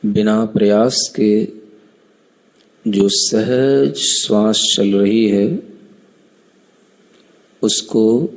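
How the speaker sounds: unhurried breathing guide with long pauses; measured, spaced-out meditation instructions; guided meditation. Acted. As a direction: lead them gently through counting breaths. Leads breath-counting meditation.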